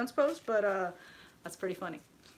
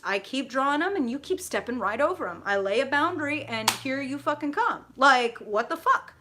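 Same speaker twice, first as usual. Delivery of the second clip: mock exasperated rant; 4 semitones higher, 6 dB louder than usual. Ranting about someone crossing boundaries.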